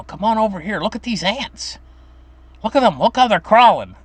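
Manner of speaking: put-on high, eager little boy; enthused kid. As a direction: call grandma over, all worked up about ants. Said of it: "and I'd get enthused".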